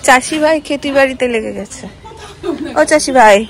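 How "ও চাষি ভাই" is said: loud call across the field, pitched up. Real, not acted. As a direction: hail him from a distance. Calling to a relative in the field.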